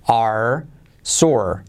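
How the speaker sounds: slow "are sore", English R at each end; key letter R. Shown as a demonstration. Teacher drills are sore slowly, R sounded. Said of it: "Letra clave R".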